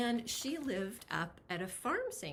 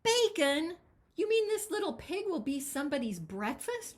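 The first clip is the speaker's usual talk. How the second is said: high, loud horrified disbelief; appalled grandmother gasping in disbelief; shocked granny; 9 semitones higher, 8 dB louder than usual. Acted. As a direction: recoil at the thought of bacon. Granny horrified about bacon.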